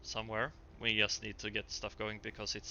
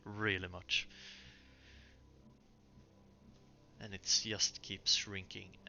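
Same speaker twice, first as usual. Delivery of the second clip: game master narration. Acted. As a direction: draw the players into the scene.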